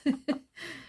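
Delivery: high-pitched short laugh; real, tickled little laugh; amused chuckle. Real, not acted. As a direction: chuckle fondly at the memory. Amused by gran's accent.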